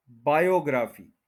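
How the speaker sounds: बायोग्राफी as baa-yo-GRAA-fee; common mispronunciation. Shown as a demonstration. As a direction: say बायोग्राफी with a full long "graa". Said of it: "तो ये बायोग्राफी नहीं".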